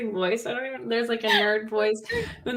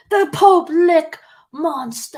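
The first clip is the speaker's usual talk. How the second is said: announcer voice; 7 semitones higher, 8 dB louder, slower than usual. Pretend. Announcing the first album.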